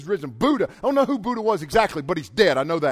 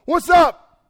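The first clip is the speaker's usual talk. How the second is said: loud, high, casual "What's up?"; casual shouted greeting; 11 semitones higher, 12 dB louder than usual. Pretend. Jesus greeting the disciples, shouted and casual. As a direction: yell "What's up?" like a buddy walking in.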